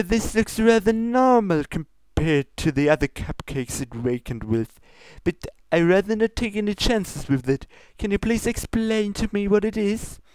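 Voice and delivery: high, formal princess scolding; lofty royal reproach, pitched high. Acted. Luna scolds Pinkie about her ingredients, high and prim.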